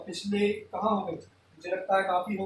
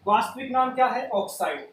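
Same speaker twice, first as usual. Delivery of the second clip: ordinary delivery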